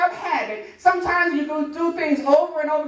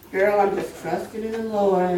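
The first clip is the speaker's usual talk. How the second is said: casual churchgoer; 8 semitones lower than usual. Retold or imitated. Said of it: "you say it so casually".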